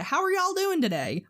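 high, singsong Southern customer-service voice; thick Southern accent. Retold or imitated. Retail worker greeting customers, thick Southern accent. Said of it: "it straight up goes into".

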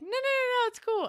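very high-pitched string of no's; retold squeaky wave-off; flustered brush-off. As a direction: imitate a hasty, high-voiced refusal.

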